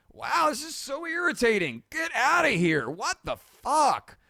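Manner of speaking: fed-up yelp at the computer; exasperated. Real, not acted. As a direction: let the irritation burst out. Software keeps crashing.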